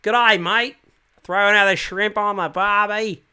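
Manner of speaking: loud, high, hammed-up Australian accent; a cartoon Aussie caught cold; broad Australian accent. Shown as a demonstration. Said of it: "if you catch me cold, I'm like".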